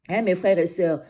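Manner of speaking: ordinary talk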